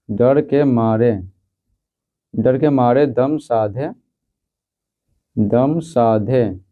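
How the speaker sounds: each phrase drawn out slowly; teacher dictating the answer, slow and drawn out; slow dictation. Shown as a demonstration. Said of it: "तो लिखेंगे".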